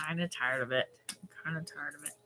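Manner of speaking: low, soft, trailing away; quietly fed up; weary admission. Real, not acted. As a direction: let it sink almost under the breath. Admits being worn down.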